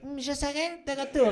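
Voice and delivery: deflate the catchphrase into a timid murmur; weak French voiceover of the Terminator; limp French dub. Retold or imitated. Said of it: "he's like".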